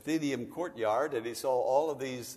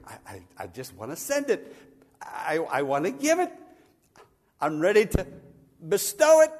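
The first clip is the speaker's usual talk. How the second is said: a hint of God's voice, eagerly rising to a loud peak; lightly voiced God pleading to give; 7 dB louder than usual. Preacher voicing God longing to give, rising eager.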